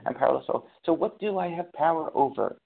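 usual speaking voice

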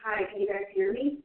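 distant speakerphone sound; talking through a speakerphone. Real, not acted. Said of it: "are you on speakerphone".

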